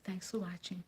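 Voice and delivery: soft voiceless whisper; demure whisper. Real, not acted. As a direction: sign off in a dainty hush. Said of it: "let me be demure".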